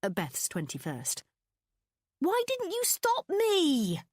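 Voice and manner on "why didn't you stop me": high, slow, louder whiny moan. A narrator's reading. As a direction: wail it out in regret. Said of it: "I'd moaned".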